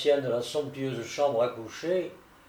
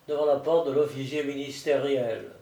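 plain reading voice

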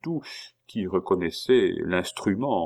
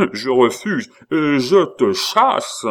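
loud, higher, slowing on the last words; angry dismissal; 6 semitones higher, 7 dB louder than usual. A narrator's reading. Abbé shows Pitou the door, angry dismissal. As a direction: throw him out with full authority.

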